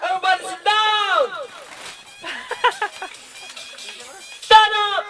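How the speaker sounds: high game host orders; called-out commands. Real, not acted. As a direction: order the whole room about. (pasigaw na utos)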